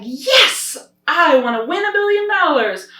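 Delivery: high, loud, thrilled yes; like you just won a billion dollars; emphatic yes. Shown as a demonstration. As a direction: burst out with a big eager yes. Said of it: "You can do an emphatic yes".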